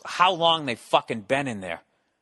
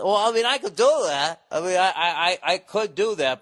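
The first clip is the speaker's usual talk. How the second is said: voiced plumber; 5 semitones higher, 4 dB louder than usual. Acts out the plumber's reluctant reply.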